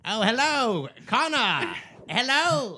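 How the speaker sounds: put-on high, old-fashioned phone voice; old-timey voice. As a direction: answer like an operator from the 1920s. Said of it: "like, an old-timey voice".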